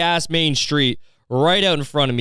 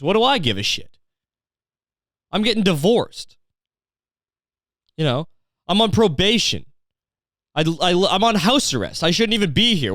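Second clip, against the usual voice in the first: high-pitched, put-upon complaining; comically aggrieved barfly confession; exasperated bar patron; 6 semitones higher than usual. Acted. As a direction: play a down-on-his-luck regular protesting. Voices an indifferent bar patron.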